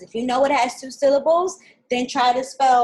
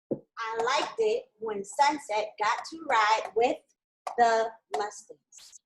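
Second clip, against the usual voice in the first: "I liked it when Sunset got to ride with the Mustangs" said slowly, higher at the start; slow dictation; 3 semitones higher, much slower than usual. Shown as a demonstration. Teacher rereading the sentence for students, slow. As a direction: read it out again slowly. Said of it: "The sentence is supposed to say".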